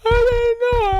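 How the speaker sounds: very high, mock sobbing sorry; crying apology. Son apologizes to mama, high hammed-up sob. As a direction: wail your apology.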